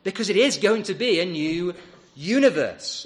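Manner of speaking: "universe" pressed loud and firm with a falling tune; "a new universe" with the weight landing on "universe"; stress on universe. Shown as a demonstration. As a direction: lean on the word "universe" as the key point. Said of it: "I want to stress the word universe".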